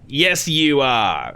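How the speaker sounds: high, drawn-out Aussie host voice; hammy slow Australian reply; Flynn Diggory Doo. Retold or imitated. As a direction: stretch it out in a bad Australian accent. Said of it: "doing a horrible Australian accent".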